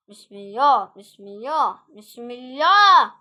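loud, drawn-out invocation; bracing cry. Real, not acted. Player invokes God before danger, bracing cry. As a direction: belt it out slowly, bracing for trouble. Repeated invocation builds under pressure.

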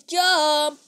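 loud shout. Real, not acted. Jumping in a game.

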